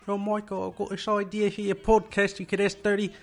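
a loose impression of a polite British voice; mock Britishy accent. Michael imitating his colleague's pitch, light mock British accent. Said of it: "She sounds a little Britishy".

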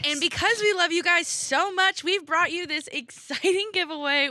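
high, loud giveaway pitch; hyped ad read. Acted. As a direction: sell the giveaway with big excitement.

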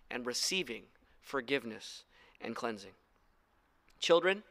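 usual speaking voice

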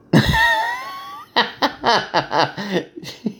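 long, high-pitched real laughing fit; helpless laughter. Real, not acted. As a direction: burst into high, uncontrolled laughter. Cracks up at the book's texts.

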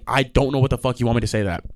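ordinary delivery

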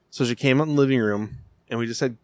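ordinary talk, nothing marked

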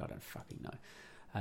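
under-the-breath whispered curse; whispered aside. Real, not acted. Host unsure of a name, whispered aside. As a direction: mutter it to yourself, barely voiced.

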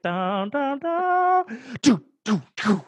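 scattered loud mock rifle booms; imitated offbeat gunshots. Mimicking the off-time salute, scattered booms. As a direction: booms falling out of sync. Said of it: "then it was like".

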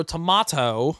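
"tomato" said tuh-MAH-toh; tomato, "ah" vowel. Shown as a demonstration. Settling on the A plus rating, "tomato" as tuh-MAH-toh. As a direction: stretch an open "ah" in "tomato". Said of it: "That was the A plus".

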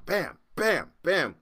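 high, punchy "bam" impact noises; imitated slamming. Storyteller acting out the safe hitting the ground, punchy slams. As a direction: pound out each bam like a blow.

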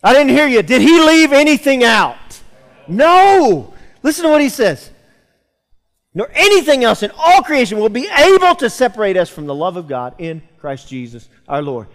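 loud, high, rousing sermon reading of scripture; pulpit-pounding proclamation; fiery preaching. Acted. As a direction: preach it out to the church with full force. Preaching Romans eight aloud.

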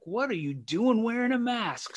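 mock yell. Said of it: "people were yelling at him".

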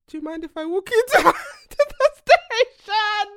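high, loud, slow, laughing through it; mock the cop's offer, laughing; imitating policeman. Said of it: "he goes".

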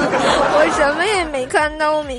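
slow giggling protest. Acted. Teasing after being slapped.

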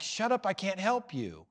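high, helpless exasperation; tough-love exasperation; exasperated, not hateful. Shown as a demonstration. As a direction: protest it firmly, without any hate. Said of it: "It's like".

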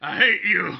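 loud laughing mock insult. Real, not acted. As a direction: blurt it through laughter.

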